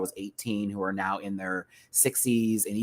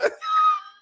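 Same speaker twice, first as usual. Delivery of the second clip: shrieking laugh; 3 dB louder than usual. Real, not acted. Laughing at quote.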